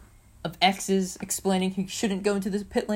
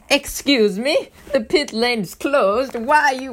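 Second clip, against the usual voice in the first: slow, high, loud mock British accent; a failed stab at sounding British; attempted British accent; 7 semitones higher, 8 dB louder, much slower than usual. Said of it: "that's not British".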